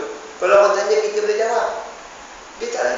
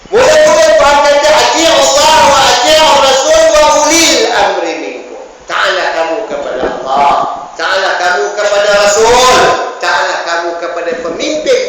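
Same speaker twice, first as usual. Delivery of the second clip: loud, high, ringing preacher's voice; full-force sermon delivery; forceful preaching; 6 semitones higher, 10 dB louder than usual. Acted. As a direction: proclaim the verse to the whole hall. Preacher quoting a verse forcefully.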